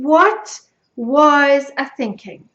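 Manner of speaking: slow, heavily stressed "what was I thinking"; incredulous self-reproach. Real, not acted. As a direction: spell out your disbelief, word by word. Mock despair at her old project.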